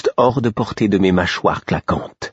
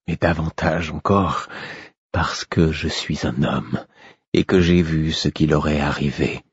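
aveu bas et doux, par fragments; douleur contenue; 2 semitones lower, 3 dB quieter than usual. A narrator's reading. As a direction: confier sa peine sans hausser la voix. King explains his grief to fool. (roi grave et meurtri, à mi-voix)

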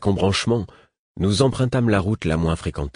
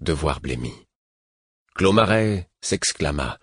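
ordinary delivery

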